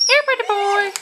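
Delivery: high sweet pet voice. Real, not acted. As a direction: coo at the puppy, high up. Calling a puppy.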